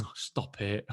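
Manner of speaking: mock bashful. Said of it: "And I was like".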